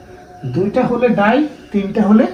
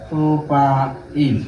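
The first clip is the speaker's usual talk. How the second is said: slow spelled-out propyl; 5 semitones lower, much slower than usual. Shown as a demonstration. Teacher stretching the word for students, slowly. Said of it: "প্রোপাইলটা আছে চার নম্বর কার্বনে".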